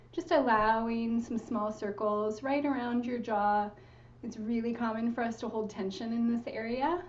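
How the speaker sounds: ordinary delivery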